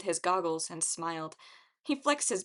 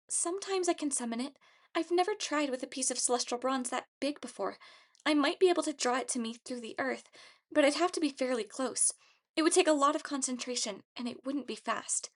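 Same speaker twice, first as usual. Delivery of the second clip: higher, hesitant character voice; higher-pitched character dialogue; young heroine's voice; 6 semitones higher than usual. A narrator's reading. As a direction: voice her pitched up, thinking it through.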